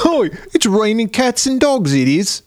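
high, slow hammed-up Cockney; mock Cockney. Telling David about the punk girls, hammed-up Cockney. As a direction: ham up a Cockney girl. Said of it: "they're all Cockney".

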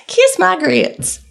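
high, drawn-out sassy Southern catchphrase; Flo from Alice. Acted. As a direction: do a slow, hammed-up Southern waitress accent. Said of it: "I went with Flo from Alice".

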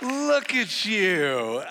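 loud, slow, high-pitched welcome shout to the church; hype welcome. Real, not acted. Pastor firing up the congregation, loud and slow. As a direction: pump up the crowd with a drawn-out call.

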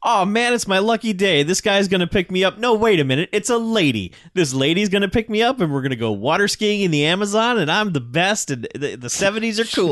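high, giddy hitchhiker gushing; hammed-up starry-eyed enthusiasm; mock excitement. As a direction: get carried away by your great luck. Imitating hitchhiker seeing car coming.

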